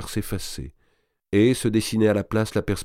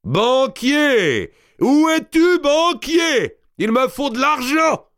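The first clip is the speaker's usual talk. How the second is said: booming shout, much higher and louder; voice thundering; 7 dB louder, much slower than usual. Acted. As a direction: bellow it down the stairs for the banker. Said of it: "Une voix tonna". (une voix qui tonne, criée très fort)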